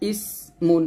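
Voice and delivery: ইসমুন drawn out very slowly with a thin, light স; light sin sound. Shown as a demonstration. Teacher showing students the thin sin, slowly. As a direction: stretch ইসমুন slowly, keep the সিন thin. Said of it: "সিন পাতলা করে উচ্চারণ করবেন".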